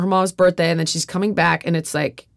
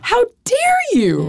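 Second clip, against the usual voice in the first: inner outrage; slower than usual. Retold or imitated. But deep down I was like.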